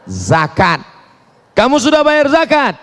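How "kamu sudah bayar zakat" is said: high, forceful mock interrogation; penanya tegas. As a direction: demand an answer from the worshipper. Acted questioner interrogates worshipper. (suara tinggi menginterogasi)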